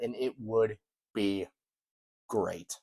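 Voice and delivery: normal talking voice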